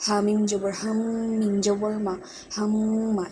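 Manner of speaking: হাম্মা with the m hummed through the nose; nasal gunnah. Shown as a demonstration. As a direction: hold the ম in হাম্মা as a long nasal hum. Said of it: "এখানে মিমটা গুণ হবে".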